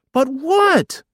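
very high, loud plaintive wail; whining child. Acted. Boy still badgering his brother, whining. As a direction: wail the question like a small boy. Child escalates in food quarrel.

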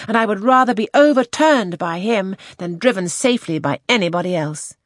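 put-on high, loud, ardent young lady; enthusiastic. A narrator's reading. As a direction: declare it with passionate enthusiasm. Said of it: "It was spoken with enthusiasm".

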